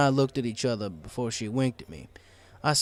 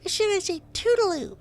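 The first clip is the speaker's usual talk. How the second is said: much higher, indignant little filly; squeaky filly; 4 dB louder than usual. A narrator's reading. Sweetie Belle denies it to Scootaloo, indignant. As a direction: protest in a tiny squeaky voice.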